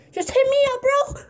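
squeaky hype shout; hype pitch. Real, not acted. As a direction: sell it to the viewers. Pitching himself to viewers.

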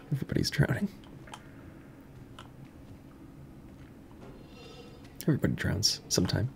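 hushed, then low and fast like a creepy narrator; spooky mock prophecy, under the breath; ominous whisper. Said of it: "What does that even mean?".

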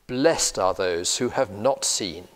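usual speaking voice

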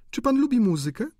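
high, bright sudden question; countess voice. A narrator's reading. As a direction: switch topics lightly. Countess changes subject.

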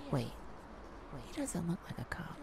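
hushed whisper while hiding. Acted. Thief hiding from a stranger, whispering. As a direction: keep it under your breath so he can't hear.

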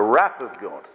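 "wrath" rhyming with "math", punched; American pronunciation. Shown as a demonstration. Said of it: "when I'm in the States".

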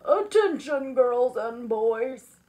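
loud, drawn-out call for attention; slow, booming summons; howled announcement. Acted. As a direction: howl it out to the whole room. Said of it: "appeared and howled".

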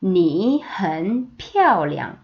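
very slow, syllable by syllable; slow teacher demo; slow model reading. Shown as a demonstration. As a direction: spell out 你很漂亮 slowly. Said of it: "같이 읽읍시다".

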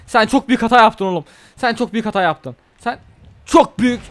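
loud threat at the opponent. Real, not acted. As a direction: shout the warning.

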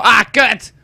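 loud frustrated "Ah, kut!" shouted out; a sudden angry curse at the screen; retold yell. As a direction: yell it like the game just crashed again.

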